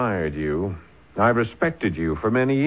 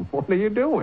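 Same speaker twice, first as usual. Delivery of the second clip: laughing surprise; 9 semitones higher than usual. Acted. Sworn in as deputy unexpectedly.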